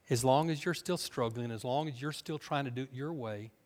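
usual speaking voice

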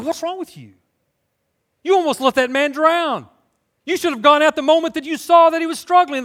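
high, indignant scolding, growing loud; imitated angry crowd. Crowd turning on the lifeguard after the rescue, imitated angry rebuke. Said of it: "the crowd had turned on the lifeguard".

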